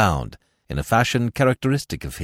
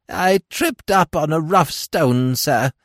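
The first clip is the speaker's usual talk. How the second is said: put-on high, slow, louder old crone whine; whined; 8 semitones higher, 5 dB louder, slower than usual. A narrator's reading. Old woman whines to Smith about her fall. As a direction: whimper like a hurt old hag. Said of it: "whined the old creature".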